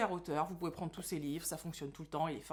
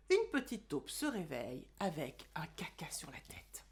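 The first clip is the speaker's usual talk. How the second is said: chuchoté; 4 semitones lower, 4 dB quieter than usual. Acted. Lecture de l'album en chuchotant.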